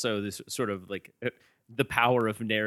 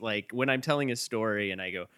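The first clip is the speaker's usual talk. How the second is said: ordinary talk, nothing marked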